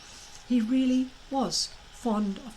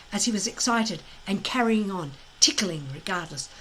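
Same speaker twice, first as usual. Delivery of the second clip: plain reading voice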